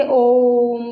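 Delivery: ओम held long, the o stretched well past two beats; drawn-out om. Shown as a demonstration. Teacher demonstrates a prolonged ओम to students. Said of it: "जैसे हम ओम का उच्चारण करते हैं".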